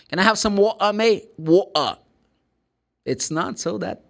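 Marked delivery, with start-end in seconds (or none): 0.1-2.0 higher, louder Cockney with "water" as "wa'uh"; Cockney accent. Acted. Teacher playing a Londoner asking for a drink, Cockney accent. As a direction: ask like a Cockney, dropping the t. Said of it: "they say water".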